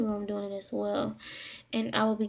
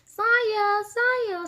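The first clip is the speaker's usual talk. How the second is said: imitating a brother's high, loud call; retold high-pitched holler; calling out a name; 12 semitones higher, 6 dB louder than usual. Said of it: "He was calling my name".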